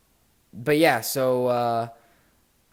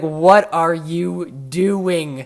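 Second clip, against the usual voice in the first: slow, drawn-out, louder exasperated yelling; retold disbelief; 4 semitones higher, 7 dB louder, much slower than usual. Yelling at Joe for not just scoring, slow exasperated shout. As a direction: stretch it out in outraged disbelief.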